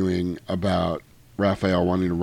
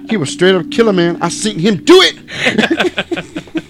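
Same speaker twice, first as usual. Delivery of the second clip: much higher, loud laughing gangster brag; Crip voiceover; 10 semitones higher, 5 dB louder than usual. Retold or imitated. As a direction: hype up the little droid. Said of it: "voiced by a Crip".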